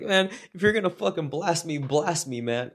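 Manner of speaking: coworker's line squeezed out high through laughter; quoting him while cracking up; laughing quote. Retold or imitated. Retelling coworker's line to manager, laughing. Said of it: "And he goes".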